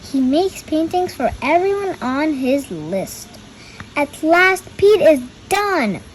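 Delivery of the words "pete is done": usual speaking voice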